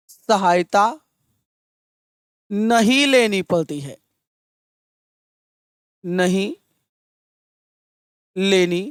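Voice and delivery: word-by-word classroom dictation with long pauses; measured, broken-up teacher's dictation. Real, not acted. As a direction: dictate in short phrases, leaving time to write. Dictates a definition for writing down.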